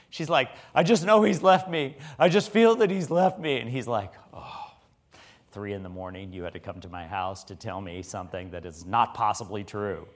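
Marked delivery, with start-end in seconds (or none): 0.7-3.6 higher, louder insistent lament; retold distress, pitched up; imitating upset woman. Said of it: "and she is upset". 4.3-10.1 low, much softer exasperation; weary retold grumble. Tired man, woken, answers her.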